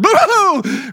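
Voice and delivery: high, loud playful "Boo-hoo!"; mock scare shout. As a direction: jump out and yell it like a kid. Kids jumping out to scare.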